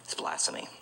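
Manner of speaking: hushed, voiceless whisper; breathy whisper. Real, not acted. Preacher whispering to the crowd about blasphemy, hushed whisper. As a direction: drop to a grave whisper. Preacher condemning cursing God's name.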